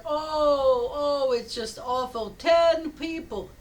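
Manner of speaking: loud, high, drawn-out moan; mock anguish. Villager complaining to rabbi of crowded house, mock anguish. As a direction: groan it out as a hammed-up complaint.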